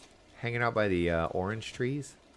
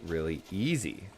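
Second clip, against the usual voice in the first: ordinary talk, nothing marked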